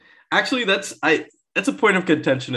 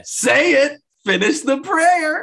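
very high, loud, drawn-out villain taunt; hammed-up goblin taunting his victim; 5 dB louder, slower than usual. Villain taunting the aunt mid-prayer, high hammed-up taunt. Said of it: "like, taunting her".

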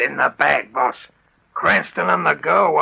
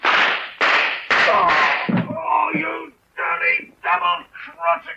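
desperate shout; 5 semitones higher, much slower than usual. Acted. Cornered villain cries out.

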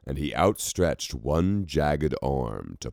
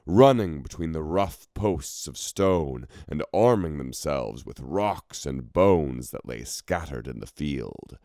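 ordinary delivery

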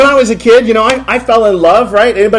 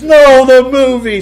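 wife's retort; 7 semitones higher, 5 dB louder, slower than usual. Retold or imitated.

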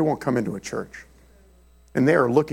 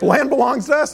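high, louder incredulous echo; retold disbelief; 10 semitones higher, 4 dB louder than usual. Baffled visitor echoing the partner's claim back, incredulous.

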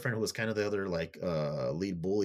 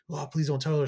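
higher-pitched mild mock plea; retold begging; 7 semitones higher, 4 dB louder than usual. Bully pleads with her victim not to tell, mock begging. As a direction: plead lightly, as if retelling it. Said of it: "begging and stuff".

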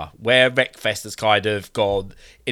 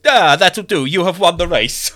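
mock game voice; 8 semitones higher, 6 dB louder than usual. Mocking the game's announcer.

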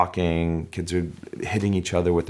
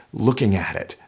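ordinary talk, nothing marked

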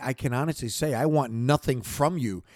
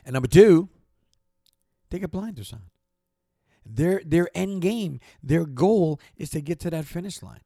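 getting excited; 4 semitones higher, 5 dB louder than usual. Real, not acted. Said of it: "I just got excited".

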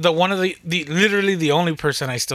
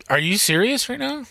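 pitched up high in disbelief; incredulous; 9 semitones higher than usual. Retold or imitated. Telling a coworker about a band name, a high incredulous question. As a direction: throw the question back in disbelief.

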